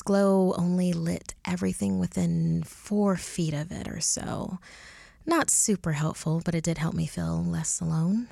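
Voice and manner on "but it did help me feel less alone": ordinary delivery, nothing marked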